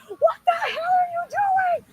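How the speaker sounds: high, slow shrieking mother; a mother bursting in alarmed; panicked mom yell. Retold or imitated. Mom rushing in from the living room, shrieking high and slow.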